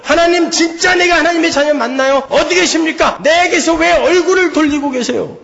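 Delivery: high-pitched, desperate questioning; crying out to God. Acted. Preacher voices believer doubting he is God's child, crying out. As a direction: demand answers in an anguished plea. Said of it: "이런 부르짖음이 우리에게서 나와야죠".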